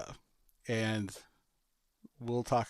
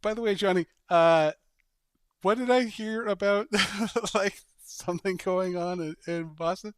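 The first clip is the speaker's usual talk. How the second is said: higher, teasing, laughing aside; sly weather girl; 5 semitones higher, 3 dB louder than usual. Pretend. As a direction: innocently slip in a dig at the anchor. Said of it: "she's like".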